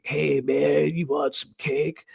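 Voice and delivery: loose, easy "Hey, man"; laid-back stoner guy. Retold or imitated. Narrator imitating the coworker's greeting, lightly voiced laid-back drawl. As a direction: toss it off like a mellow burnout. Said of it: "He was like".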